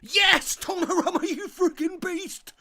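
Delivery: high, loud cry of joy breaking into a laugh; ecstatic yell. Real, not acted. Streamer cheering his keeper's save, high joyful yell. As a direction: explode with relief at the save.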